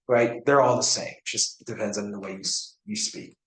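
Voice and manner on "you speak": ordinary talk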